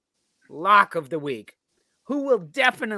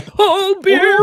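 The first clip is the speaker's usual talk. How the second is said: high, loud wailing voice; Paul Bearer impression; 13 semitones higher, 8 dB louder, slower than usual. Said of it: "the best voice was Paul Bearer".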